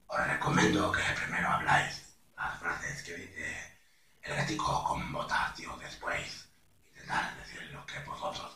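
low, hushed, husky Spanish cat; El Gato con Botas. Shown as a demonstration. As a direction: breathe it softly in hoarse Castilian. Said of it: "hablar lento".

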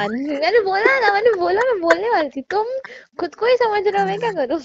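laughing protest. Real, not acted. Girlfriend protests playfully, laughing.

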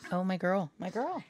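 delighted high-pitched fussing; high squeals of delight. Real, not acted. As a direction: pitch shoots way up, squealing oh my God at her. Reacting to a dog's antics.

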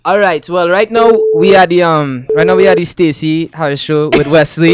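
easygoing Trinidadian lilt; casual chat in a Trinidadian accent. Shown as a demonstration. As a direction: speak with your Trinidadian accent. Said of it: "Drop some words with that accent".

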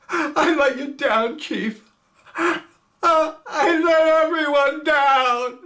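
high, slow, tearful cry rising loud. Acted. Gunner crying his shame to the chief. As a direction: wail the guilt out. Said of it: "Milstein crying".

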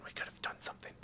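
voiceless disappointed whisper. Real, not acted. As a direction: breathe it under your breath. Teammate retreats, muttered aside.

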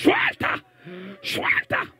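very high, piercing cry; forceful command. Real, not acted. Preacher ordering a spirit to let go, forceful command. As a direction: cry it out sharply to drive something off.

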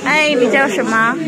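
ordinary talk, nothing marked

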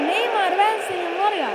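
normal talking voice

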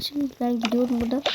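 ordinary talk

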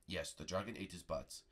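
low, soft master, falling end; solemn confirmation. Pretend. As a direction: confirm it gravely in a deep voice. Prego confirms the tale.

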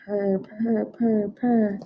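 lightly imitated cat purring. Shown as a demonstration. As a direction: purr softly like a cat. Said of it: "Can you all purr?".

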